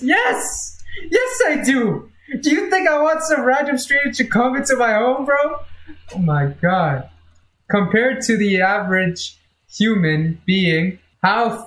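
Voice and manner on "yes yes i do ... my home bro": high, fast indignant yes; how dare you even ask; indignant outburst. Real, not acted. Indignant answer to question.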